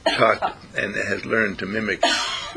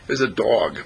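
normal talking voice

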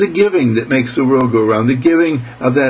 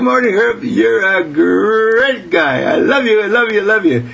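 high-pitched, gushing little fish; playful high voice, drawing out the praise; adoring goldfish voice; 10 semitones higher than usual. Retold or imitated.

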